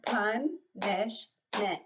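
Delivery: punishment drawn out very slowly in three beats; clapped syllables. Shown as a demonstration. Teacher clapping punishment slowly for the class. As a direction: clap punishment slowly beat by beat. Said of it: "if we clap that word".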